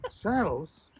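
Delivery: mock outraged repeat of saddles. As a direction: echo saddles in outraged surprise. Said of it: "And she says".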